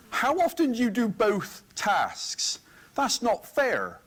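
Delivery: high, slow, hammed-up moan; mock indignant whine. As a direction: put on a whiny aggrieved voice. Mock complaining boss voice.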